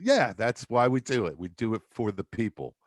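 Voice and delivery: normal talking voice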